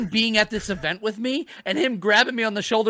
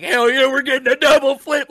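louder, higher excited cheer; hyped-up friend; 4 semitones higher, 8 dB louder than usual. Retold or imitated. Ethan grabbing me by my shoulders, hyped-up cheer. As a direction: imitate a pumped-up fan shouting.

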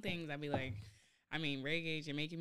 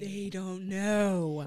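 slow and loud, drawn out; slow emphatic; 2 semitones higher, 11 dB louder, much slower than usual. Real, not acted. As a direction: stretch every word out.

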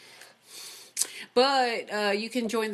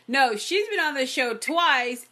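ordinary talk

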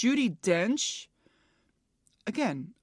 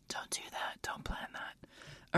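whisper; 15 dB quieter, slower than usual. Real, not acted. Whispered aside to herself.

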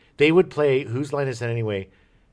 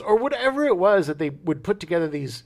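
ordinary talk

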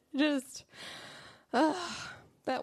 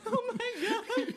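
mock sob, slow and higher; hammed-up crying child; imitating sobbing; 11 semitones higher, slower than usual. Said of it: "like, sobbing".

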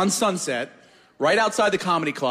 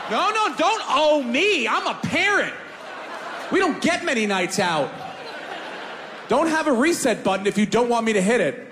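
shouted protest; 9 semitones higher than usual. Retold or imitated. Comedian acting his pool outburst.

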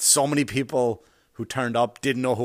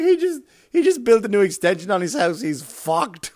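laughing joke; 8 semitones higher, 5 dB louder than usual. Retold or imitated. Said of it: "I was like".

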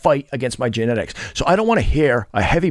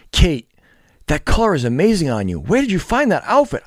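lightly raised, sugary comment-reader voice; mock gushing fan; 6 semitones higher than usual. Mocking a fan's sweet comment, mildly gushing. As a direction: ham up the fan's praise a little.

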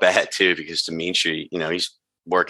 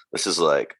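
ordinary talk, nothing marked

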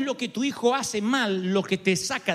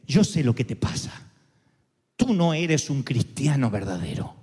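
much lower, a cold accusing whisper-close voice; voz acusadora; 8 semitones lower than usual. Retold or imitated. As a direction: speak as the accuser at the mirror, low and sure. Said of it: "viene alguien y se te para al lado". (voz grave y acusadora, en tono de enemigo)

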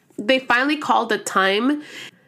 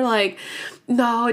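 ordinary talk

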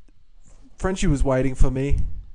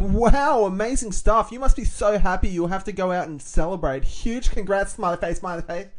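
put-on high-pitched gushing girl act; 5 semitones higher than usual. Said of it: "Can you be the girl voice?".

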